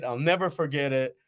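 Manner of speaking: ordinary talk, nothing marked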